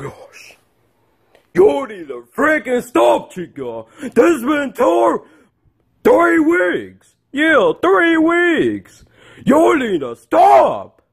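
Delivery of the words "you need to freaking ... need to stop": high, drawn-out scolding; exasperated scolding. Acted. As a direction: scold a naughty kid at the end of your patience. Puppet dad scolds misbehaving chicken.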